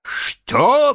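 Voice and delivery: high, loud, stunned outburst; dismayed cry. A narrator's reading. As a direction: cry out in disbelief. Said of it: "вскричал обескураженный барсук". (ошеломлённый вскрик)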